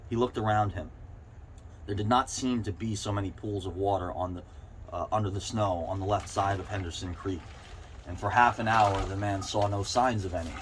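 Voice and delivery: usual speaking voice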